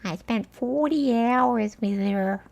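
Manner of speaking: slow, irritating put-on lawyer voice; annoying Cashman voice. Lawyer recusing herself from the case, mocking impression. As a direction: drag it out in a badly faked American accent. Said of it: "She had a voice kinda like this".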